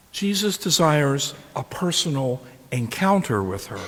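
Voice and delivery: normal talking voice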